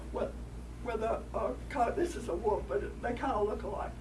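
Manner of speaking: usual speaking voice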